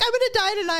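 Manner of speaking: high-pitched mock dread; hammed-up fear for her life; mock panic. Imagining herself on the freezing flight, mock panicked.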